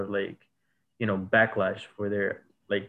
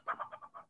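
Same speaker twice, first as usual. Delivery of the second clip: gunshot sounds; 19 dB quieter, faster than usual. Retold or imitated. Gunshots heard every night.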